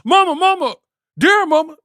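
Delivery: very high, loud wail for mama. Retold or imitated.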